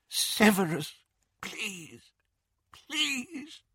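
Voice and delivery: high, breaking whispered plea; desperate pleading. Acted. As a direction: beg in a fading whisper. Captive begging Snape.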